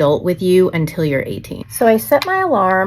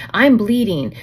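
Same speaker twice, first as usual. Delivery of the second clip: normal talking voice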